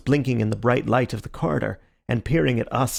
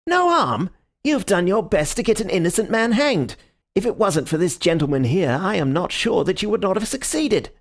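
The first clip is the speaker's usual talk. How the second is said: angry detective; 8 semitones higher than usual. A narrator's reading. Detective rounding on the culprit, angry outburst. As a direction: tear into him with outrage. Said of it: "furious red face of the angry detective".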